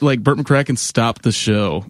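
ordinary talk

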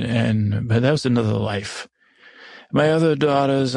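plain reading voice

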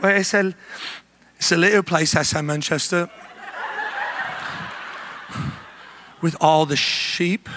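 put-on British accent, hesitant; improvised mock-British answer. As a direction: bluff your way through in fake British. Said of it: "we're in full character".

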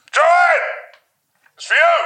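high loud call across the house; shouting to another room. Acted. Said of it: "shouts from the other room".

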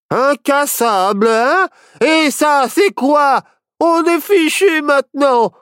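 "Incassable" thrown back high and mocking; mock the word "Incassable", then rise to a high despairing outburst; high, drawn-out accusing rant; sarcastic panic. Companion throws boast back, panicking.

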